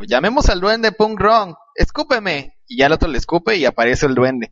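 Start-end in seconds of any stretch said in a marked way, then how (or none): 0.0-2.5 lightly acted kid, drawn out and higher; mock-solemn kid's summons; kid in a comic. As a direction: call out a summons in a higher, slow voice. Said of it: "sale un chavito diciéndole al otro".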